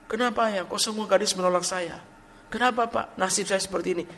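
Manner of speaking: mock the lovelorn guy asking why, pitched high; sulking young man. Pastor imitating the young man whining to him, high. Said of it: "oh dia marah-marah, Pak".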